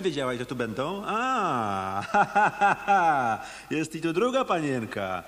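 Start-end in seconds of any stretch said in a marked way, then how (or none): 2.1-3.4 mocking laugh. Detective mocks the women.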